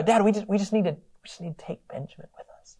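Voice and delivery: trailing off into a whisper; nervous whisper. Retold or imitated. Sons timidly asking their father for Benjamin, whispering. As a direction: barely dare to ask.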